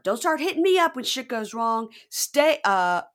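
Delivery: a mock yell at his ex. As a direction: yell it at her. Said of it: "[name] starts yelling at her".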